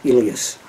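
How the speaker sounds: Elias said as ee-LEE-us, stress on LEE; bagel shop owner's pronunciation. Shown as a demonstration. Telling fans how the bagel shop owner says Elias, ee-LEE-us. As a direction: Elias with long E sounds, ee-LEE-us. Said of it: "same spelling, it's".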